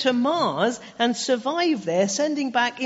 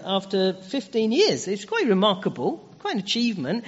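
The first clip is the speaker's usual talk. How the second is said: ordinary delivery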